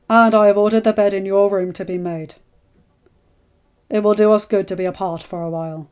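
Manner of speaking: loud, cold commanding husband; stern lord. Acted. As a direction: hand down orders with no room for reply. Ned's stern orders to wife.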